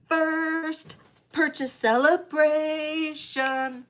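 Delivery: slow drawn-out announcement; slow announcer build-up; announcing. Acted. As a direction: stretch each word like a fanfare. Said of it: "I didn't do this for your first purchase".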